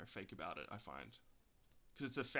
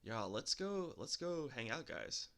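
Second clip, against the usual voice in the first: put-on American accent; 5 semitones higher, 4 dB louder than usual. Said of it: "did you hear my American accent?".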